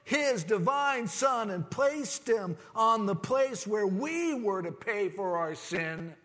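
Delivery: loud, high, impassioned preaching. Acted. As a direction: preach it out to the whole congregation. Preaching at full force.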